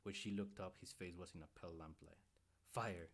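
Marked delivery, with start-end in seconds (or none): none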